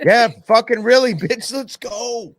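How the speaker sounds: squeaky mock voice. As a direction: squeal it like a cartoon kid. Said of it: "He's like".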